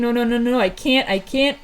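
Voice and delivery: mildly panicked string of refusals, slowing at the end; acted freak-out. Thunder freaks out over her failing powers, mildly panicked. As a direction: play a light overwhelmed fluster. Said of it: "freaking out and shaking".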